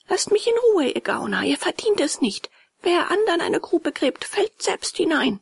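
put-on high, indignant boy's voice; high-pitched character voice, firm; scornful puppet boy. A narrator's reading. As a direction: refuse them in a high boyish voice. Puppet boy rebuffs the beggars.

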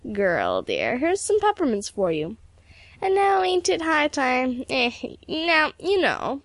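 put-on folksy Irish old man, pitch swooping; teasing old peddler. A narrator's reading. Old driver hinting at marriage to girl, teasing. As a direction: tease fondly in an old Irish lilt.

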